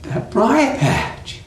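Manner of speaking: high, stuttering, desperate plea; begging Brer Rabbit, frantic. Acted. Rabbit begs fox not to throw him, high frantic plea. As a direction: plead in high bursts and stammers.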